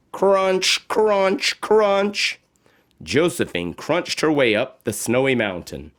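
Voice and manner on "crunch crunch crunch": higher, chunky crunching; crunch sound words. A narrator's reading. Narrator sounding boots in snow, higher crunches. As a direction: stamp out each crunch.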